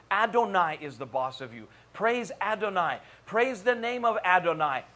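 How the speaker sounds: emphatic, punched repetitive declarations; forceful preaching. Acted. Preacher declaring who is the boss of you, forceful preaching. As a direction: drive each line home to the congregation.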